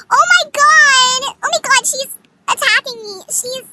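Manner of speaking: high, drawn-out dramatic yell; imitating an overdramatic victim's cry; mock outcry. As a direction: wail loudly as if under attack, hammed up. Acted cry of being attacked.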